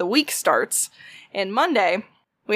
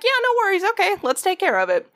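bright, high forced-friendly "no worries"; fake cheerful; 10 semitones higher, faster than usual. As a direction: sugarcoat it through a tight smile. Fake cheerful cashier reply.

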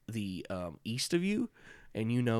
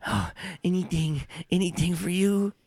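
higher eager goblin voice; groveling goblin; 8 semitones higher, 4 dB louder than usual. Acted. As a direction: beg eagerly, fawning. Goblins grovel before queen.

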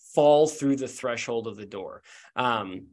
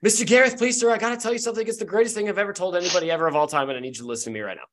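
put-on high-pitched kid rattling on in a rush; eager young boy gushing without stopping for breath; cheerful voice; 5 semitones higher, much faster than usual. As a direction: burst in high and fast, tumbling out news. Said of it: "you hear a cheerful voice go".

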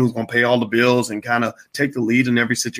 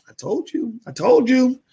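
very high, teasing mock thought; privately crowing in a high-pitched voice; gloating inner voice; 12 semitones higher, slower than usual. Boyfriend silently vindicated after waiter's mistake, high-pitched gloating.

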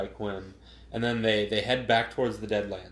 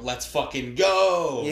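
drawn-out, louder hype cheer; hyped cheer; 3 semitones higher, 5 dB louder, slower than usual. Retold or imitated. Co-host reads his movie notes aloud to his friend, hyped cheer. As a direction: stretch it out like a fan celebrating.

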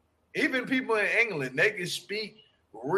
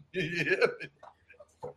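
usual speaking voice

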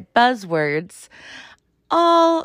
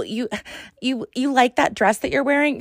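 ordinary talk, nothing marked

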